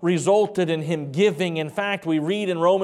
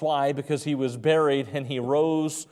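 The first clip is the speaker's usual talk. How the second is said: usual speaking voice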